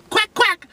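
a quick silly quack; duck quacks. Pretend. Vlogger quacking while naming the dish.

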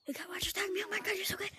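breathy high mock fan gushing. To a friend, mocking fans in a breathy gush.

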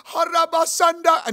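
mock speaking in tongues. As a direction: blurt out glossolalia. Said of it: "I was in tongues".

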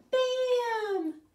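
very high, loud bam; shrieked sound-effect bam; shouted bam. Acted. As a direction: burst out like a popping pan. Rhyme punchline sound.

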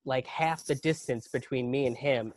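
normal talking voice

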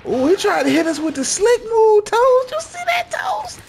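very high, loud mocking squeak; teasing falsetto to teammate Toes; mock squeal. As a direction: squeal it in a silly cartoon voice. Mocking enemy's slick move.

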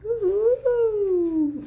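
high drawn-out whoop; excited whoop. Real, not acted. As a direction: whoop as you fly down. Sliding down again. (uhul agudo e prolongado)

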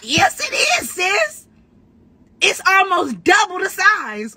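retold yell. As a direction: yell like you're winning the argument. Retold heated argument, yelling back.

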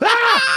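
high, loud screeching cry; cat screech. Retold or imitated. Mimicking the cat's screech as the fight breaks out, loud high cry. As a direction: shriek like a cat launching into a fight.

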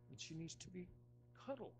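ordinary talk, nothing marked

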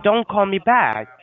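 firm repeat. Real, not acted. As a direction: says it again loud and slow so the caller finally gets it. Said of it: "I said".

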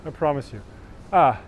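ordinary delivery

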